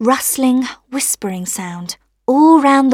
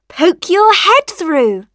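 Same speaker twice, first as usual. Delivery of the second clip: high, slow, louder prompt; little voices urging; 8 semitones higher, 6 dB louder, slower than usual. A narrator's reading. Rain and sun to rosebud, high urging.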